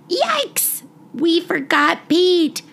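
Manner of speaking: high squeaky yelp of alarm on yikes; a kid suddenly remembering the dog, high alarmed cry. Acted. Child in the car realizing they left the dog behind, high startled cry. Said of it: "I suddenly cried out".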